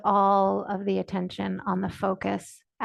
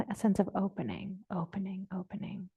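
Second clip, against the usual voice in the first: each repeat softer, down to a near-whisper; fading softly; 8 dB quieter than usual. Shown as a demonstration. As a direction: let the words trail away into a whisper. Teacher models softening into openness.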